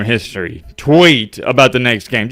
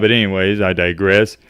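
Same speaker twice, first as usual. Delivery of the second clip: ordinary talk, nothing marked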